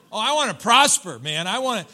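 high, loud begging cry; mock craving. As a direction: ham up a greedy churchgoer begging God to prosper. Pastor voices a congregant's craving.

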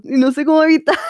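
giggling over own admission; laughing while talking. Real, not acted. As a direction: laugh through the embarrassment. Embarrassed confession about loud chewing.

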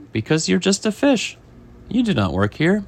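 high, emphatic put-down; lightly acted telling-off; scolding chef. Chef answering the complaining fish, lightly acted scolding.

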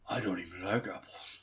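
Batman voice. Acted. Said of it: "how Batman would sound".

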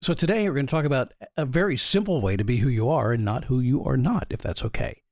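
ordinary delivery

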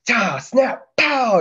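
high, punchy mouth sounds of shots; imitated bullet impacts. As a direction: pop each impact out sharply.

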